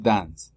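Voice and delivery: "dance" with the final S audible; wrong sounded S. Shown as a demonstration. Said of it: "La S es muda". (dance con la S final sonora)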